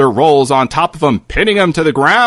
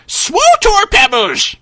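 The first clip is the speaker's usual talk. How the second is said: put-on high, slow old man's call; high old wizard calling out a spell; shouted spell; slower than usual. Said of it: "The old man shells out".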